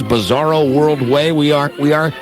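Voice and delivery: usual speaking voice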